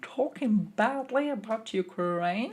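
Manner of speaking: mock Twitter outrage. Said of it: "They were like".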